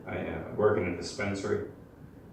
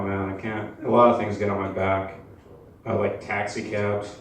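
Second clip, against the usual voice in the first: usual speaking voice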